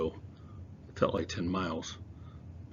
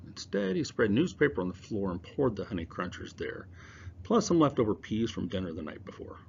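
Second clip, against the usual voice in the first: usual speaking voice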